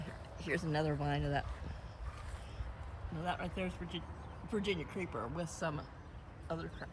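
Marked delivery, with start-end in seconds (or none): 3.1-6.9 hiccuping mid-sentence; words broken by hiccups. Real, not acted. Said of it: "Sorry, I get the hiccups now".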